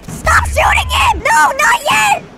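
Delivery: real shouted orders, high and drawn out; urgent shout. Real, not acted. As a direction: stop them before it's too late. Teammates shooting too early.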